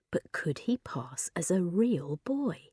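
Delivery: soft, slow whispered worry. A narrator's reading. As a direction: whisper the doubt to yourself. Frankie's worried inner question.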